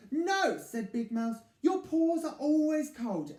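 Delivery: loud, high put-out refusal; grumpy refusal. Acted. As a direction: grumble the excuse. Big Mouse refuses.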